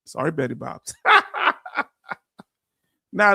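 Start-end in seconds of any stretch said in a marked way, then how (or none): 1.0-1.8 laugh. Real, not acted. Laughs after apologizing to Betty.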